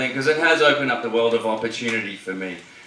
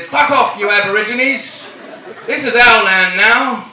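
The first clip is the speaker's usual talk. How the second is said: high, raised-voice mock command; mock aggressive order; 6 semitones higher, 4 dB louder than usual. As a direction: play a settler ordering people off the land. Acts British settlers claiming land.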